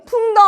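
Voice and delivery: high-pitched, loud "풍덩"; voiced splash. Storyteller showing Peter's jump to children, high loud splash word. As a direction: punch out the splash sound.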